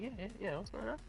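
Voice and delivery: a sly, hushed put-on voice; mock shady guy. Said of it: "He's like".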